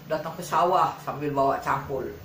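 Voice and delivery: usual speaking voice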